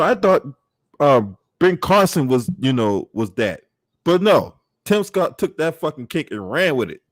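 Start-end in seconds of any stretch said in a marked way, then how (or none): none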